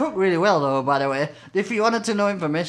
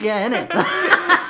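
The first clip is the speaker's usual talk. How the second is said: put-on slow, higher Scottish accent with a laugh; hammed-up Scottish accent; 6 semitones higher, 5 dB louder, much slower than usual. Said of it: "Straight into the Scottish accent".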